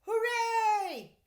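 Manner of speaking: put-on high, loud boyish cheer; cried out. A narrator's reading. Boy cheering from down the shaft, loud. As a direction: shout it up from the shaft. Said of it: "Stanley cried out".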